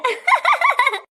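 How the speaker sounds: high cackling burst. Acted. Guests hearing the bride lisp, high mocking laughter.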